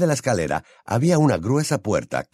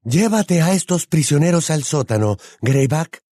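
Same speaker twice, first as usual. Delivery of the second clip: higher, louder commanding voice; raised, authoritative female character voice; ordering; 7 semitones higher, 5 dB louder than usual. A narrator's reading. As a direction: give a firm order to an underling. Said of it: "ordenó al hombre lobo".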